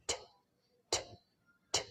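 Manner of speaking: "T" crisp and aspirated; t sound demo. Shown as a demonstration. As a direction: flick "t" off the gum ridge. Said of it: "Okay, all right".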